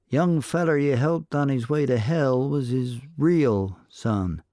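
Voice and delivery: a sly saloon barkeep angling for a drink. A narrator's reading. Barkeep bargaining with the cowboy for gossip, sly.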